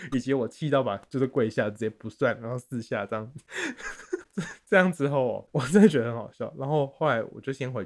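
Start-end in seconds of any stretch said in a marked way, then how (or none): 3.4-4.6 squeaky falling laugh; high laughing fit. Real, not acted. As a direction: let a high laugh spill out. Laughing at own failure. (尖声笑出来)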